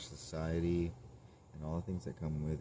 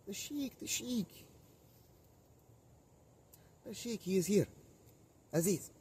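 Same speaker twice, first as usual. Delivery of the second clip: high put-on sheik voice, loud and proud; 5 dB louder than usual. Playing a sheik, announces his arrival high and loud.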